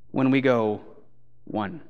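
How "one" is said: very soft, low deadpan; hushed, low imitation of a stubborn child; toddler's firm answer. Us answering God like the two-year-old, soft low deadpan.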